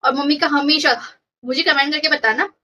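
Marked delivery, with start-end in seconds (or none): none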